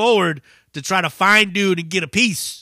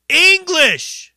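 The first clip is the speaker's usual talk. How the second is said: high, loud jeering shout; mock heckler yell; 14 semitones higher, 8 dB louder than usual. Heckler shouting at the Spanish-speaking singer, loud jeer. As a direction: bark it back at the stage. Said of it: "And dude said back".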